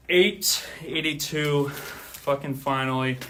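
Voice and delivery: loud, slowed relief at the count's end; relieved finally. Real, not acted. Streamer finishing a marathon count, loud relief. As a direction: let the last number burst out with relief.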